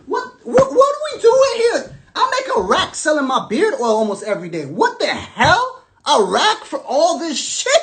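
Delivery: high, loud mock disbelief; incredulous outburst. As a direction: squeal it out like you can't believe the pay. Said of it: "I was like".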